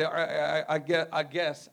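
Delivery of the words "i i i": ordinary talk, nothing marked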